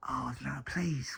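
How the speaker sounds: slow, hushed plea; whispered dread. Retold or imitated. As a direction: whisper it like a pleading private thought. Dread on recognising the voice.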